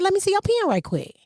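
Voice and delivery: "pen" said like "pin", high and drawn; "pen" with a Southern pin vowel; "pen" rhymes with "pin"; country pen. Retold or imitated. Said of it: "Are you saying pen?".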